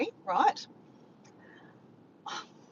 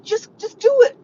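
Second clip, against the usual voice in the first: loud, high, impatient yell; urgent order; 12 semitones higher, 14 dB louder than usual. Retold or imitated. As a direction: re-enact frantic insistence. Re-enacts impatient order to Diego.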